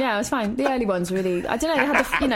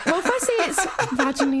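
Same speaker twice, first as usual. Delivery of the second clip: laughing correction; 7 semitones higher, faster than usual. Retold or imitated. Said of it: "I was like".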